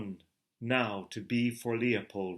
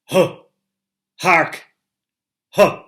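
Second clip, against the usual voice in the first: sharp, loud, much higher barks; short loud exclamations, much higher; startled cries; 7 semitones higher, 17 dB louder than usual. A narrator's reading. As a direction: cry out like a sudden alarm. Thunder and ghosts of beasts.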